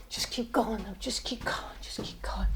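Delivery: breathless whisper to himself; whispering just keep going; whispered self-encouragement. Retold or imitated. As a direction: whisper it to keep yourself moving. Muttering to himself while running.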